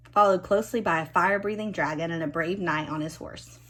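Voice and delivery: ordinary delivery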